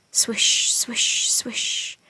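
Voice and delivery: whispered, fading sleigh sound; whispered swish. A narrator's reading. Narrator voicing the sleigh over snow, fading whisper.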